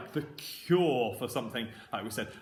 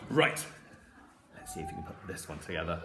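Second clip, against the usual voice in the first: ordinary talk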